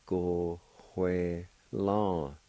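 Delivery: "go hue lan" said very slowly, each syllable stretched; slow demo. Shown as a demonstration. Teacher modelling the third part "go hue lan" slowly. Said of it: "Third part".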